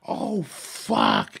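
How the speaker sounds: gasp, then a loud, high exclamation; acted sudden realisation. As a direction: play the moment it dawns on you. Acting sudden realisation after correction.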